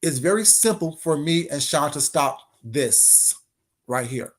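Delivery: usual speaking voice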